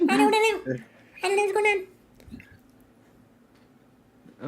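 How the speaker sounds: very high squeaky chipmunk voice. Retold or imitated. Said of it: "Megan's still talking in chipmunk voice".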